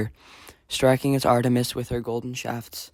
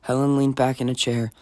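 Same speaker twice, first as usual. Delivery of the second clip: ordinary delivery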